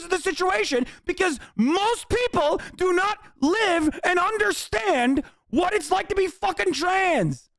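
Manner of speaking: furious and fed up, pushing each line out; angry rant. Real, not acted. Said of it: "This shit makes me so mad".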